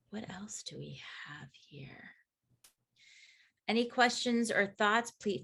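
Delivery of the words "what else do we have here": slow, near-whisper, very soft; half-whispered aside to oneself; murmur to oneself. Real, not acted. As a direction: think aloud under your breath while checking what's next. Checking notes aloud to herself.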